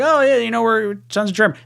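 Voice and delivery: high, bright mock-boast about German roots; mock proud relatives. Mimicking family bragging about German roots, mock proud. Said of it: "they're like".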